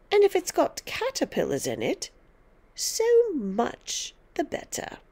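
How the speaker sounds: put-on high, emphatic orders to a child; bossy old woman; domineering grandmother, bossy. A narrator's reading. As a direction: lay down the law with relish.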